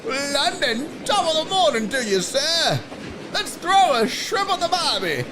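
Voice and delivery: high, put-on British accent sliding into Australian; hammy, badly faked British-Australian accent; botched British accent. Streamer teasing a London viewer, botched British accent. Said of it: "Every time I try to do a British accent".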